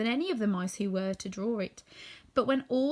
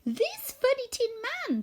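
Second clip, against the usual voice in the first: much higher, gracious little voice; squeaky mouse queen. A narrator's reading. Mouse queen praising the woodman, high squeak. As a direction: thank him royally, squeaking.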